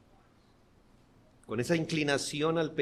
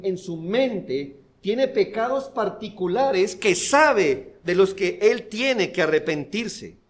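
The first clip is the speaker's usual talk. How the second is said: loud, higher preaching, pressing each phrase; full-force preaching; 6 semitones higher, 7 dB louder than usual. Acted. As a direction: drive the point home from the pulpit. (predicación enérgica y fuerte)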